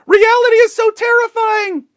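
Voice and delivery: high, loud hammed-up panic; mock terror. Commentator mocks journalists' fear of reality, hammed-up high loud terror.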